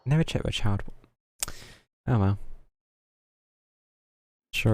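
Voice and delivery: ordinary talk, nothing marked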